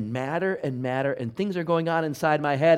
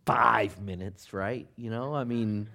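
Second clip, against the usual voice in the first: inner voice; 3 semitones higher, 7 dB louder than usual. Retold or imitated. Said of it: "and you think".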